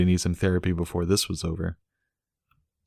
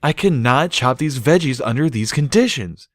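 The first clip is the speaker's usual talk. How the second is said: slightly higher stallion protest; 7 semitones higher, 4 dB louder than usual. A narrator's reading. Bucky protesting to the family, lightly voiced. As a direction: protest while fighting laughter. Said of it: "Bucky protested".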